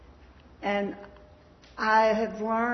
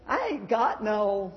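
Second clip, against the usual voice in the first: mock Southern accent; 2 semitones higher than usual. Speaker mocking how the show portrays Southern talk, light Southern twang. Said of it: "everyone with a Southern accent ... doesn't say".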